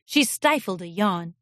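ordinary delivery, nothing marked

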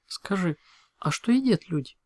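lightly voiced curious pony; curious unicorn. A narrator's reading. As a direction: ask with gentle curiosity, voice lifted. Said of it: "поинтересовалась единорожка".

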